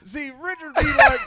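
mock comic voice. Mocking comics doing bits.

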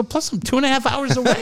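squeaky high put-on voice; high mock voice. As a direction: pitch it way up like a joke. Joking retold aside.

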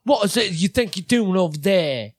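high put-on British boss voice; Andrew Tate. Showing co-host how he talks, high mock British voice. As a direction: pitch it way up like a caricatured overseer. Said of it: "That's, like, my Andrew Tate impression".

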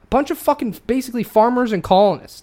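high, loud, real indignation; angry rant. Real, not acted. Ranting about farmers who fought tooth and nail, angry. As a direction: hammer it out in a heated rant. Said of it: "it makes me fucking angry".

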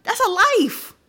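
high-pitched, impassioned insistence. Real, not acted. Impassioned plea about people being killed.

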